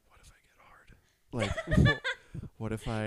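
whispered panic, breaking into a laugh; drawn-out whisper of a private thought; retold inner thought. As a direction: whisper the secret worry, half laughing. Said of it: "in my head, I'm like".

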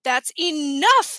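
slow "enough" with a long first ee; drawn-out ENOUGH. A narrator's reading. Mom cutting off the argument, drawn-out "enough". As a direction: stretch the opening of "enough" into eee-NUFF. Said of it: "It came out sounding like enough".

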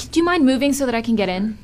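ordinary delivery

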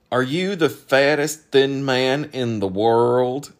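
slow, loud, high character voice; laboured, puzzled questioning; sceptical questioner. Acted. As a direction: draw the question out heavily.

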